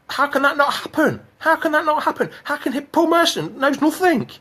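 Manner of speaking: high-pitched incredulous protest; indignant. Real, not acted. Fan rants at Paul Merson's claim, indignant. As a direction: protest it high, each phrase insistent.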